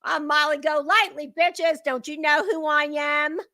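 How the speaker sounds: mocking Molly. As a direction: sneer it like a spoiled star. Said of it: "and she was like".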